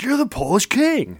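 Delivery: imitated thrilled fan, high and slow; excited. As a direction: recognize a local legend with awe. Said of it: "He got all excited".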